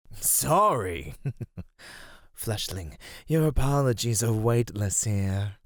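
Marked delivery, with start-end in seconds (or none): 0.1-1.1 raised, louder mocking repeat; scornful echo. Creature to the apologizing fleshling, scornful echo. 1.2-2.1 short, soft dismissive laugh; scoffing chuckle. Acted. Creature hearing a useless apology, soft scoffing chuckle.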